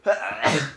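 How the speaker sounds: sudden high sneeze burst; sneezing. Real, not acted. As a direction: let a real sneeze out. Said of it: "Et je vais éternuer".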